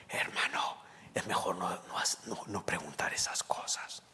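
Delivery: hushed, near-whispered warning; low voice. Retold or imitated. Minister warning his brother against asking such things, low voice. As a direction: imitate someone cautioning you under their breath. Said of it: "pero en voz baja".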